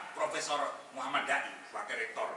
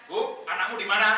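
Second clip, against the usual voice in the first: loud, higher demanding husband; mock angry husband; 7 semitones higher, 9 dB louder than usual. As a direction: bark the question at the wife in jest. Angry father to wife. (suami pura-pura marah bertanya keras kepada istri)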